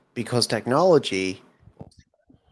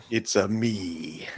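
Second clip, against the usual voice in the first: slow, lightly put-on Italian accent as Mario; 2 semitones lower, 4 dB quieter, much slower than usual. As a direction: drawl it out like a cartoon plumber. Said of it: "this really does sound like, like Super Mario Brothers".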